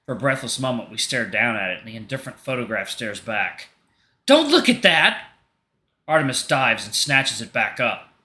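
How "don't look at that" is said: high, loud, slow outraged yell; panicked angry shout. Acted. Artemis shouting at Leo and Hunter over the license, high furious yell. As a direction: yell it to stop their eyes.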